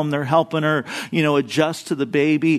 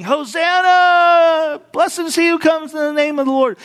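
high, loud joyful acclaim, Hosanna ringing out; crowd cheering; 11 semitones higher, 4 dB louder than usual. Retold or imitated. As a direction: shout it like a cheering crowd at the gate. Crowd acclaiming Jesus quoted.

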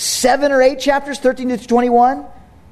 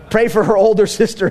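normal talking voice

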